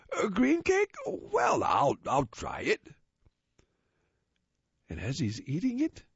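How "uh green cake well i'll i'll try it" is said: pleased. Acted. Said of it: "He starts to smile".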